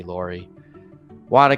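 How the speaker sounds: ordinary talk, nothing marked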